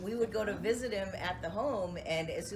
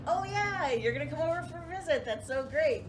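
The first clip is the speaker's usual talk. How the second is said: high bright mock-delighted dad; cheery imagined dad; 9 semitones higher, 4 dB louder than usual. Daughter imagines dad's cheerful welcome, high and gushing. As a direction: gush like a happy father. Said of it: "Wouldn't you think he'd be like".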